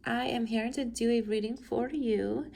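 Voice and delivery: normal talking voice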